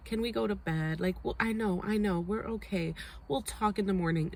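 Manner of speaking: dismissive sleepy mumble; mock tired dismissal. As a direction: wave him off, tired. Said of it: "And she's like".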